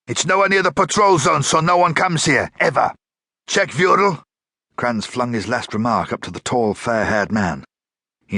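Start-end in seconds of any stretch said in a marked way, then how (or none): 0.1-4.2 high, loud angry rant; furious. A narrator's reading. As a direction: keep the fury going. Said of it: "a sudden burst of fury".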